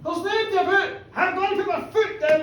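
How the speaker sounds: high and loud, revving himself up; reckless glee. Acted. Drunk farmer gleefully boasting, high excited voice. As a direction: dare yourself to floor it.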